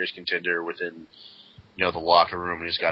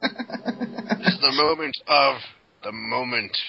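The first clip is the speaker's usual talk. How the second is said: slow, higher mock proclamation; mock solemn promo; 5 semitones higher, much slower than usual. Parodying the wrestler's moment speech, mock grand proclamation. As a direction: draw it out like a pompous speech.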